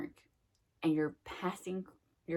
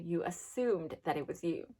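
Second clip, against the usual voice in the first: usual speaking voice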